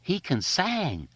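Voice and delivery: high, slow screeching caricature voice; a kid mocking a friend's singing in a high, slow screech; put-on dialect voice. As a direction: screech it way up high, drawn out slowly. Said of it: "Richie screeched in his pickaninny voice".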